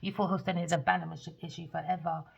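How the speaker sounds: normal talking voice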